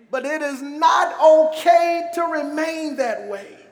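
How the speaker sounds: high, slow, declamatory preacher voice; emphatic preaching. Real, not acted. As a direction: preach each phrase out, stretching the key words. Preaches the key refrain with force.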